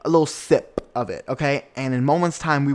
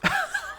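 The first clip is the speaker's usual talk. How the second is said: a quick high squeal of a laugh; a teasing high squeal; high mocking laugh; 5 dB quieter than usual. Shown as a demonstration. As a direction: show the laugh you use to shut a friend up. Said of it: "we go".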